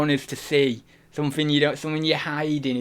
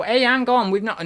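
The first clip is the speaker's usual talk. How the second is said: abortive impression, pitched up; Peter Way; 8 semitones higher than usual. Streamer starting a requested impression offhand, lightly put-on high voice. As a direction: briefly put on a higher voice. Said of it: "Impression of Peter Way".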